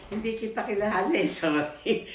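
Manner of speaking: sheepish giggly apology; laughing apology. Retold or imitated. Girl apologizing to the boss she scolded, laughing. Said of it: "Sabi ko".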